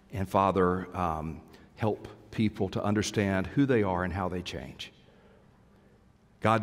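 prayer. Acted. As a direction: pray gently over the congregation. Closing prayer.

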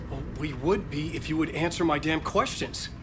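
exasperated retort. Acted. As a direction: push back, fed up with evasions. Exasperated retort to evasive caller.

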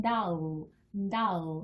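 "다우" as English doll, ending in a dark L; slow doll with an "eul" ending; dark final L. Shown as a demonstration. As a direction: finish 다우 with a slight 으 into L. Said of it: "끝부분에 으 소리로 마무리".

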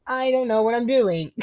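ordinary delivery

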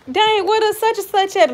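high-pitched mock "dang" of disbelief; acted dismayed surprise. Telling viewers about raided leftovers, in high mock dismay. Repeats the reaction to missing food.

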